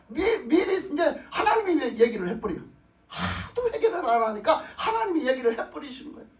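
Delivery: ordinary talk, nothing marked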